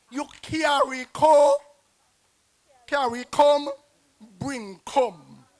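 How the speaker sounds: loud, called-out Creole saying; loud quoted saying. Retold or imitated. Poet calling out a Creole saying loudly. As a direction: belt out the local proverb.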